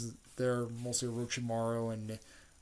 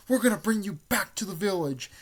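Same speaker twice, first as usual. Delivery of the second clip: high, loud cartoon-hero declaration; acted urgent vow; 8 semitones higher, 6 dB louder than usual. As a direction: call it out like a determined anime hero. Retelling Naruto's urgent vow to Sasuke.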